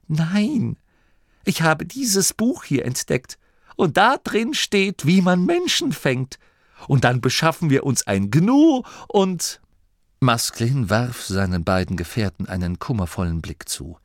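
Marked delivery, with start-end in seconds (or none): 0.1-9.6 high, excited youngster's voice; Vinto, eager boy. A narrator's reading. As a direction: pitch a big discovery eagerly. (eifriger Junge, hoch und begeistert)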